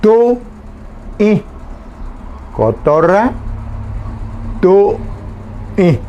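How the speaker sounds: "Tu y" with a high, separated nasal y; nasal vowel. Shown as a demonstration. Teacher isolating the nasal vowel for students. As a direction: lift "Tu" high, then a nasal "y". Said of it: "Y la I nasal".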